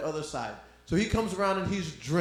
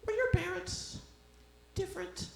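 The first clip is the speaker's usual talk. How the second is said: high put-on questioning voice; mocking stranger; 4 dB quieter than usual. Comic mimics a stranger asking about his parents, high and mocking.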